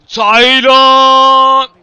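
sharp loud call; 1 shouting name. Real, not acted. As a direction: urge him on with a shout. Calls player in attack.

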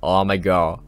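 ordinary delivery

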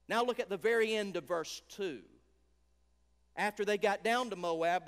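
ordinary talk

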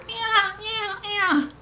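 high, shrill wail imitating a newborn's first cry. Mother recalls her son's first cry, imitating it shrill and high. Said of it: "I just heard this shrill".

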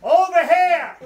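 imitated holler across the woods, high and loud; loud retold shout; calling out. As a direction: yell it to someone far away. Jimmy calling back in the woods.

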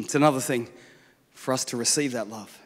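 hushed. Acted. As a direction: draw them in with a hush. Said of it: "intimate aside".

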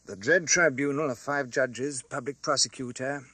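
ordinary delivery, nothing marked

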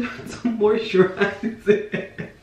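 slow words breaking into laughter; real laugh through the words. Real, not acted. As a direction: crack up while finishing the sentence. Cracks up at own analogy.

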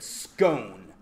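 "scone" with a long o, rhyming with "bone"; drawn-out long-o "scone"; North American pronunciation. Shown as a demonstration. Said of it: "Canadians and Americans have ruined it and said".

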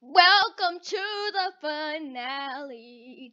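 drawn-out, half-sung grand welcome; playful singsong announcement; mock announcer. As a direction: announce it slowly like a show host, tongue in cheek.

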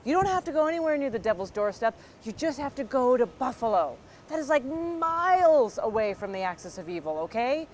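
high, quick burst of placating reassurance; hurried, high-pitched pleading with reason; frantic rationalizing. Retold or imitated. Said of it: "you're just trying to rationalize with them".

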